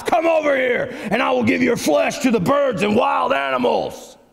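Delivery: hammed-up giant's shouted threat; cursing Goliath. Goliath cursing David by his gods, threatening shout. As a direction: hurl it as a mock villain's curse. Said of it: "he cursed David by the names".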